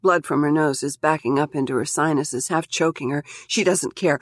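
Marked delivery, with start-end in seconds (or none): none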